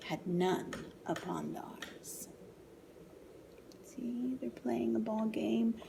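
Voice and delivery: hushed sad whisper. A narrator's reading. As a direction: drop to a whisper. Story whispered for effect.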